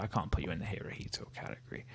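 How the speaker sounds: fast, breathy whisper to yourself; quick conspiratorial whisper; whispered aside. Real, not acted. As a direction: mutter the verdict under your breath.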